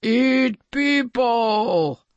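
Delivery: slow, high monster bellow; drawn-out storybook monster voice; roaring sea monster. A narrator's reading. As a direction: roar it like a hungry beast. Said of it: "roared the sea monster".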